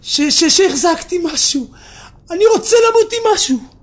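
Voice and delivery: stammering into a high, loud plea; acted desperate shout. Sick man wanting to die with one merit, high pleading shout. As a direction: plead loudly, rising in pitch. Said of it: "הוא התחיל לצעוק".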